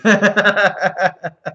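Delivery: a sudden, loud laugh at a viewer's comment; loud burst of laughter. Real, not acted. Laughing hard at a viewer's odd comment.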